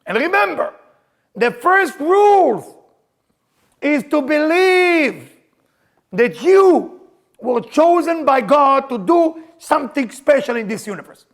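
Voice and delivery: high, loud, very slow sermon; fiery preacher stretching every word; preaching at full force. Acted. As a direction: draw each word out to the crowd.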